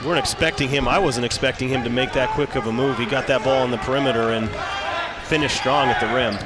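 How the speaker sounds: ordinary delivery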